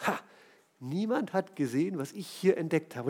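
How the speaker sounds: leiser, verstohlen gedacht; heimlich leise. Retold or imitated. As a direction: still für sich, damit's keiner hört. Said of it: "guckt er sich ängstlich um". (leise und heimlich gedacht)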